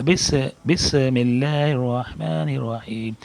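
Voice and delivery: بس، بسم اللہ الرحمن الرحیم with بس split off first; slow recitation, a separate بس before بسم; common mispronunciation. Shown as a demonstration. Teacher imitates how people wrongly recite, slow and drawn. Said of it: "عام طور پر آدمی ایسا پڑھتے ہیں".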